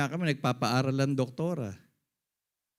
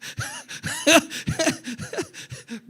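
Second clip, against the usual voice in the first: high laugh; 4 dB louder than usual. Real, not acted. Laughs at his own story.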